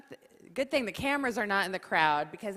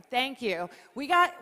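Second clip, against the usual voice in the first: ordinary talk, nothing marked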